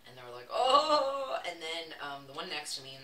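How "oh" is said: loud, high imitated oh; hammed-up chorus of delight; mock delighted reaction. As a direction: imitate the guys swooning loudly. Listeners' delight at y'all, retold.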